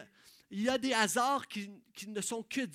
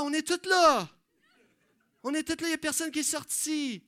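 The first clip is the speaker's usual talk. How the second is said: much louder, high, driving sermon shout; full-force preaching; 8 semitones higher, 12 dB louder than usual. Acted. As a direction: pound the story out to the crowd.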